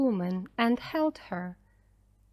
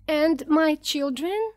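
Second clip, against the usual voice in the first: high, slow anxious question; mother's mild cry, pitched up; 6 semitones higher, 4 dB louder than usual. A narrator's reading. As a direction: draw out a worried plea, rising. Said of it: "cried Missus Dawson".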